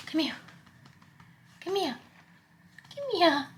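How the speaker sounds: high-pitched repeated cat call; coaxing a pet. Real, not acted. Owner calling Binksy over, coaxing in high pitch. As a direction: coax the cat closer in a high voice.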